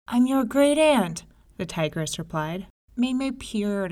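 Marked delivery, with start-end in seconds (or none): 0.1-1.2 fake sweet aunt. Tigress pretends to be aunt.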